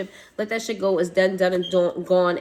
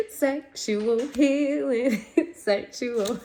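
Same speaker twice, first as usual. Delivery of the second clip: slow, drawn-out words broken by laughter; amused, stretched-out singsong; laughing, singsong; 4 semitones higher, much slower than usual. Real, not acted. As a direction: croon it while cracking up. Laughingly sings out a song title.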